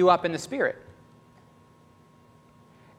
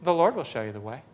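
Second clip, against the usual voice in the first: calm, understated delivery in one run; composed, confident, no fuss; matter-of-fact. Retold or imitated. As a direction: say it evenly, as if routine. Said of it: "I just said matter-of-factly".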